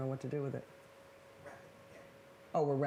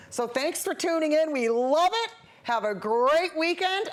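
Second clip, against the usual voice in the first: bubbly sign-off; 8 semitones higher, 6 dB louder than usual. Acted. Cheery sign-off to viewers.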